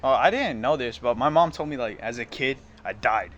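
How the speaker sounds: normal talking voice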